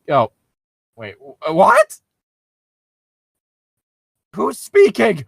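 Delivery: ordinary delivery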